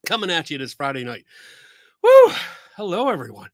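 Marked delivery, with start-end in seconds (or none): 2.0-2.3 high, loud whoop; hyped stream-opening woo; excited whoop. Real, not acted. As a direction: let out a celebratory cheer. Host cheers opening stream.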